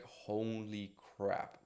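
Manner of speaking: slow, hushed holy crap; drawn out under the breath in disbelief; stunned awe. Retold or imitated. Young worker stunned by a mansion, hushed awe. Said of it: "I would just be like".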